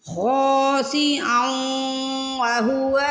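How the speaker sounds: خاسئا merging nasally into وہو; idgham with ghunna. Shown as a demonstration. Teacher showing students the merging ghunna, slow chant. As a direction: run خاسئا into وہو through the nose. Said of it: "یہاں پہ ادغام بالغنہ ہوگا".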